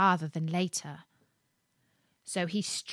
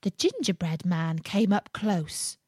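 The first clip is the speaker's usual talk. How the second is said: plain reading voice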